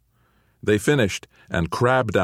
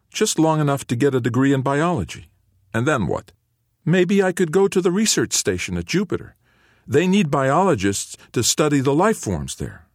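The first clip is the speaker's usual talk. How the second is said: put-on higher, eager teenage son; earnest teen; 8 semitones higher than usual. A narrator's reading. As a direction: lay out the dream with hope. Teen son shares his plan.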